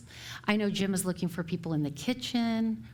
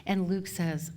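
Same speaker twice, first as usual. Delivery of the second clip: ordinary delivery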